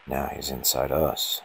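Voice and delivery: ordinary delivery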